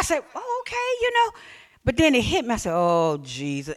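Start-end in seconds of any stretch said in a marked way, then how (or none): 0.3-1.3 high, soft, surprised "oh, okay"; mock surprise. Imitating own surprised self. 2.7-3.7 slow, much lower "Oh, Jesus"; slow low dismay. Retold or imitated. Own dismay retold.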